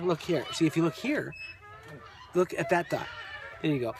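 ordinary delivery

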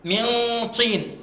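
usual speaking voice

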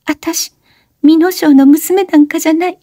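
high panting confession; breathless high words; gasping. A narrator's reading. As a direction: gasp out the truth. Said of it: "おしのはあえぐように言った".

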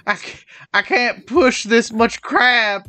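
high, loud, slow whiny plea; exhausted pleading. Pretend. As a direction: beg like an overworked fisherman. Voicing tired worker Willie.

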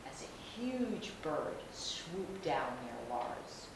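usual speaking voice

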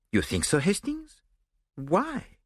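a hint of Belgian detective; French accent. A narrator's reading. Poirot voiced.

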